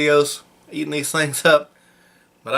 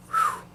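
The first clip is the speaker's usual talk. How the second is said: burning heat whoo; 6 dB quieter than usual. Real, not acted. Burning mouth from pepper.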